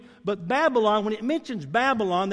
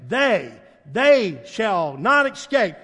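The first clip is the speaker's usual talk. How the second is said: ordinary talk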